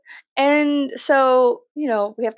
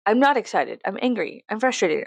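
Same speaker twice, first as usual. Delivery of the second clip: ordinary talk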